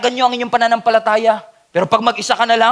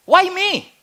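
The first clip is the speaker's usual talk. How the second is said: mock whine; 9 semitones higher than usual. Mock complaint to God.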